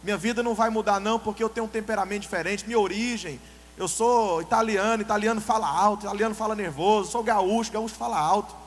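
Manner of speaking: a hammed-up stubborn believer, loud; mimicking an excuse. Preacher mimics a member blaming his Italian roots, loudly. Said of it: "Aí tem alguns que falam".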